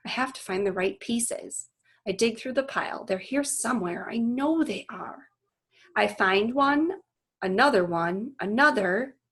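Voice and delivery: steady read-aloud voice for a class; reading a novel chapter to students; story narration. A narrator's reading. Teacher reads chapter aloud to class, storytelling voice.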